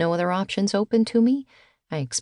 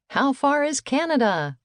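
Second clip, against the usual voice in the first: high excited shout; 8 semitones higher than usual. A narrator's reading. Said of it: "and shouted".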